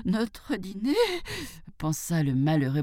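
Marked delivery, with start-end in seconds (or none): 0.1-1.0 high, scared question; frightened. A narrator's reading. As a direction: echo it in high-pitched dread. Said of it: "ses genoux s'entrechoquaient de frayeur". (effrayé, voix haut perchée)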